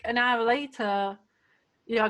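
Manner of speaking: ordinary delivery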